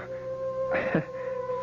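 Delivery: breathy laugh. Acted. Dizzy faint laugh.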